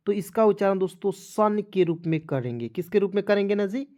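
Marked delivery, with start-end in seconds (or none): none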